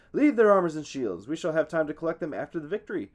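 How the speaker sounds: loud, high commanding cry, slowing on the last words; battle shout. A narrator's reading. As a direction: drive the army to the ships. Hector commands the charge.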